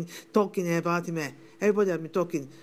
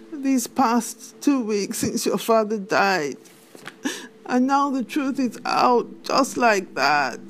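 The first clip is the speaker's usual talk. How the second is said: distraught crying; 3 semitones higher, 5 dB louder than usual. Acted. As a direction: pour out the hurt, voice cracking into a cry. Widow breaks down to daughter.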